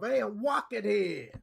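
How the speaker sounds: put-on slow, far New York street-tough accent; hammed-up Bronx accent. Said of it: "Everyone always has their stereotype of".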